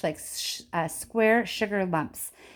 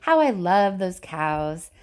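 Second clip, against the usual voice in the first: fond, slow; 3 dB louder, slower than usual. A narrator's reading. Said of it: "cried Mister Wonka".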